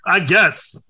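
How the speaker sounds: ordinary delivery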